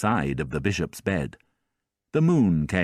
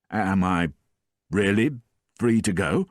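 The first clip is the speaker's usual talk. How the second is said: ordinary delivery, nothing marked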